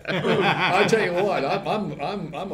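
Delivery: laughing. Real, not acted. Laughing at own tiredness.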